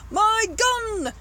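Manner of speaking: high, loud bellow; furious shout. Acted. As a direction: bellow for your weapon. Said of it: "The Duke was shouting".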